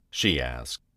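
usual speaking voice

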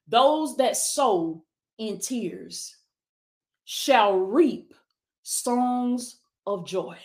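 drawn out, each word weighed, very slow; slow scripture reading. Shown as a demonstration. Preacher reading the Psalm verse aloud to viewers, slow and deliberate. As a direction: let the verse sink in word by word. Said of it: "put this in Google".

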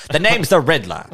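put-on high, loud old-time radio villain. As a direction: sneer it like a pulp radio villain. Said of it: "that voice kinda works".

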